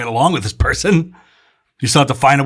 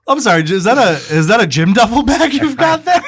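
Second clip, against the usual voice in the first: higher, mock-incredulous question; mock disbelief; 7 semitones higher, 4 dB louder than usual. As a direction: play the stunned girlfriend. Playing the incredulous girlfriend.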